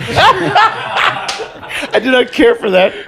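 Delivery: slow, high, prim mock mother; mock prissy mom voice. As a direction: drag it out like a fussy mother disapproving. Imitating mother's prim text.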